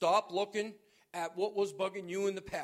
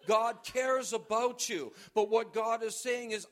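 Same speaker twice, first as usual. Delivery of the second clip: ordinary talk, nothing marked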